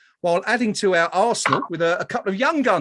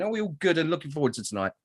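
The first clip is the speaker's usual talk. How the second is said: ordinary delivery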